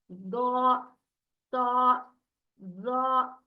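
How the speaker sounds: दो, सा, जा each alone, long and dark like "daw, taw, zaw"; thick heavy letters. Shown as a demonstration. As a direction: hold दो, सा, जा with a rounded full mouth. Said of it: "इस तरीके से इसे भी मोटा पढ़ा जाएगा". (दो, सा, जा को मोटा, खींचकर पढ़ें)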